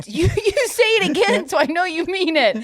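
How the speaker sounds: high-pitched, affronted challenge; mock indignant dare. Daring a friend to repeat an insult, indignant and high. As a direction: dare them in a high acted voice. Retold quarrel, own daring comeback.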